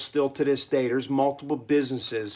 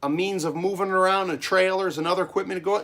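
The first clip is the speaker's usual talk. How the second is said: grandpa quizzing; 6 semitones higher, 4 dB louder than usual. Retold or imitated. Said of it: "he goes".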